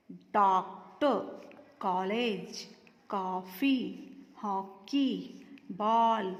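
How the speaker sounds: डॉक्टर, कॉलेज, कॉफ़ी, हॉकी, बॉल slowly with a rounded English "aw"; डॉक्टर, कॉलेज, कॉफ़ी, हॉकी, बॉल spelled out slowly by sound; clear borrowed vowel. Shown as a demonstration. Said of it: "उनके स्पष्ट उच्चारण के लिए आ".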